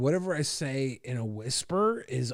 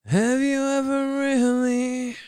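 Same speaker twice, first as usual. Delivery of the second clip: high; 10 semitones higher, 7 dB louder than usual. Pretend. Emphatic closing question.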